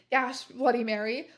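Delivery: ordinary delivery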